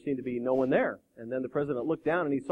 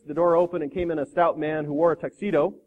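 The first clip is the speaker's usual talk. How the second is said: usual speaking voice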